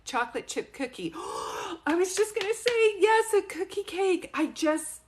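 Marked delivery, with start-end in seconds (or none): 1.2-1.8 sharp high gasp of surprise; delighted gasp. Real, not acted. Medium hearing her guess confirmed, delighted gasp. 1.9-5.0 high, loud, thrilled burst; real excitement. Real, not acted. Medium hearing her guess confirmed, excitement.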